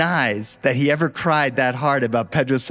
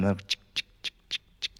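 pressure cooker hiss; 8 semitones lower, 10 dB quieter, faster than usual. Retold or imitated.

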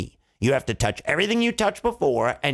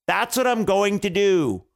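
higher, insistent "going to do"; mock-exasperated, pitched up to "do"; exasperated repeat; 5 semitones higher than usual. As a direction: hammer "going to do" at a silly listener. King repeats, more exasperated.

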